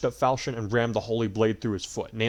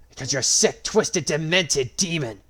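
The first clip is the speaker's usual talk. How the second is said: angry roar; 6 semitones higher, 7 dB louder than usual. A narrator's reading. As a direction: roar it in rage. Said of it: "Marth roared angrily".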